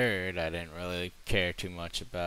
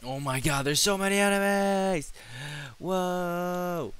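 high, loud alarmed yelling; panicked shouting; 11 semitones higher, 6 dB louder than usual. Real, not acted. Overwhelmed by enemies.

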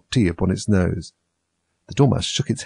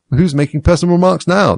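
high, loud gotcha question; triumphant; 10 semitones higher, 7 dB louder than usual. A narrator's reading. Hatter turning the tables on Alice, triumphantly. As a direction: crow over catching her out. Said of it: "the Hatter asked triumphantly".

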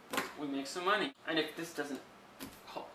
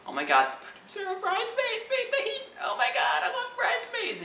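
much higher, squealing with laughter; excited fan; 3 dB louder than usual. Retold or imitated. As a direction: gush like a thrilled fangirl. Fan hyped by trailer.

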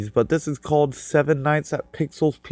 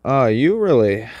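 usual speaking voice

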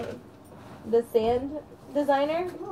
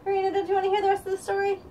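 coaxing; 9 semitones higher, much faster than usual. Real, not acted. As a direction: lift the voice up and rush it to win her back. Mother coaxing child back.

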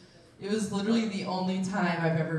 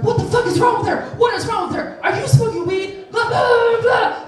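high, loud mock panic; hammed-up hysterical shouting that runs into blah-blah; freaking out; 13 semitones higher, 7 dB louder than usual. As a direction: imitate frantic people yelling over each other. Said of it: "they're, like, freaking out".

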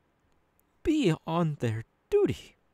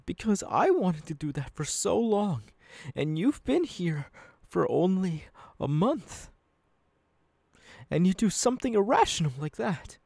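ordinary talk

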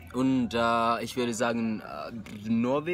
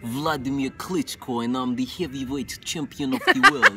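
put-on heavy Russian accent in English; Russian boxer voice. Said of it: "mein Russisch kann ich machen auf, auf Englisch".

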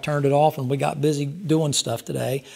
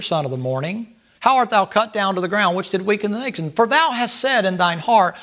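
raised, ringing scripture read, slowing at the end; 3 semitones higher, 4 dB louder than usual. Acted. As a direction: proclaim the verses like a preacher. Reading scripture in sermon.

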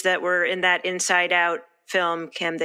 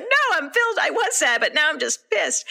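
high, laughing mock outrage; mock indignant reply; 8 semitones higher than usual. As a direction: play the offended one through laughter. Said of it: "it's like".

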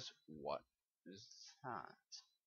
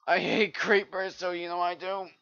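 loud rant; 9 semitones higher, 16 dB louder than usual. Real, not acted. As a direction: yell your hatred of creepers. Ranting after the creeper damage.

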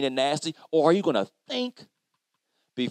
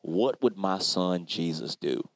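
God calmly asking Cleo to stop and think; 10 semitones lower, slower than usual. Retold or imitated. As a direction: speak as God gently correcting him. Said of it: "he said".

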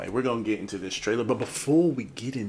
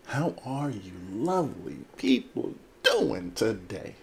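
slow, drawn-out hammed-up welcome; theatrical greeting. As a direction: stretch every word with showy swoops. Same greeting repeated, drawn out theatrically.

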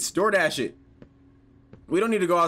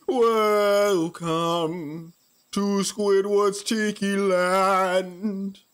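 very slow, stretched-out announcement; cartoon welcome; 2 semitones higher, much slower than usual. Quotes a remembered cartoon welcome.

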